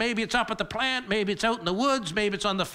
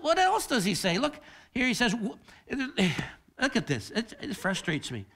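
ordinary talk

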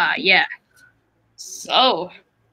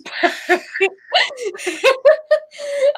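long, high-pitched fit of laughter; laughing hard. Real, not acted. Recalling a friend's funny accent, laughing hard. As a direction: crack up, high and helpless.

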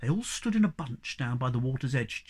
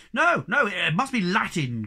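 cried out; 5 semitones higher, 6 dB louder than usual. Acted. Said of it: "cried the Hemulin".

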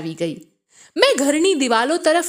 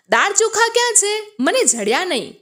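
higher-pitched, understated call to mum; mild calling out; 8 semitones higher than usual. A narrator's reading. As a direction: call out a question, without really shouting. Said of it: "મેં મમ્મીને બૂમ મારી". (હળવેથી બૂમ મારીને)

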